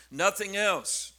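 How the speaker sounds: ordinary talk, nothing marked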